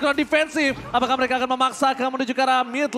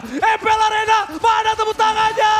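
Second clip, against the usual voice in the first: hype shout; 9 semitones higher, 5 dB louder than usual. Real, not acted. Caster hypes the arena crowd.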